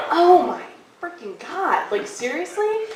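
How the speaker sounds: high, drawn-out disbelief, rising at the end; mock dismay. Storyteller acts the dog sitter finding the dog dead, dismayed. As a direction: stretch it out in appalled disbelief.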